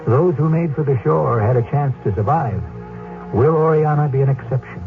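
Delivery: normal talking voice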